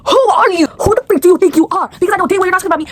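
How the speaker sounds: high, loud, near-crying outrage; outraged. Real, not acted. Girl reacting to the boy's repost about her: loud tearful outrage. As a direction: shout at him on the verge of tears.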